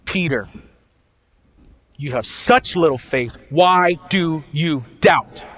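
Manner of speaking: slow disappointed rebuke, dragged out; harsh scolding Jesus. Retold or imitated. Jesus chides Peter, slow and disappointed. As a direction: scold him like a let-down teacher. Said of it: "you could picture Jesus like this".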